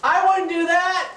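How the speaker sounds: high, loud, slow feigned innocence; mock innocent protest. Real, not acted. Husband teasing wife who says he's busy, mock innocent. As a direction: play innocent, drawn out.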